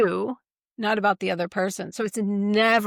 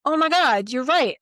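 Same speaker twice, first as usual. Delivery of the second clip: mock dramatic realization; 5 semitones higher, 5 dB louder than usual. Triggered person's dramatic realisation.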